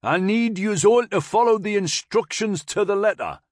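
high, loud Geordie instruction; professional Newcastle accent. A narrator's reading. Corporal to the new arrivals, professional Newcastle accent. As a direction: instruct firmly in broad Newcastle. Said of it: "Tip said in a more professional tone".

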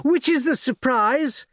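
put-on high, eager girl's voice calling Papa; a daughter spotting the ships, breathless with excitement; excited young girl. A narrator's reading. Daughter calls to her father in the crowd, high and eager. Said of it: "cried the elder girl".

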